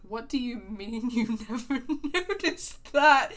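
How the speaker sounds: incredulous. Retold or imitated. Teasing her friend about Mulholland Drive, incredulous laugh. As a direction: drag it out in mock disbelief at the friend. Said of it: "And I was like".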